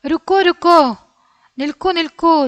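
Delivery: calling out. A narrator's reading. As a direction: call after the bird, wait, don't go. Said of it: "രൂരു ഉറക്കെ വിളിച്ച് പറയാണ്".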